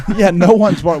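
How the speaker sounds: laughing at the barking joke. Real, not acted. Host laughing at co-host's barking remark, laughing. Joking about barking in church.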